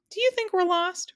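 pleading high question; high boy voice. Acted. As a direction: ask in a high boyish voice. Milo asks again.

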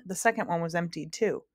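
usual speaking voice

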